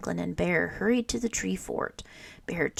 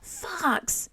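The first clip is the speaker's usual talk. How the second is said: high sharp call of a name; high dismayed cry; 11 semitones higher than usual. A narrator's reading. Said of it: "cried Franklin".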